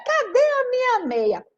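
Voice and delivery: high, slow whiny yell; child yelling. Acted. Rita calling her mom about a sock, high slow yell. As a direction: wail for the missing sock. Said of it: "ela só vivia gritando".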